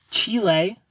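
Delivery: "Chile" said the Spanish way, CHEE-leh; "Chile" pronounced Chee-leh like a Spanish speaker; Spanish pronunciation. Shown as a demonstration. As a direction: say "Chile" as in Spanish, two clear syllables. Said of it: "Is different".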